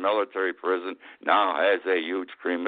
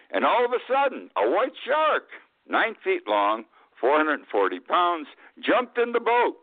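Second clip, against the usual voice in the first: excited storytelling; 8 semitones higher than usual. Acted. Shark leaps into boat.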